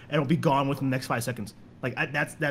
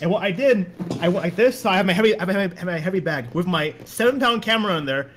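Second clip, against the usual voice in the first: ordinary talk, nothing marked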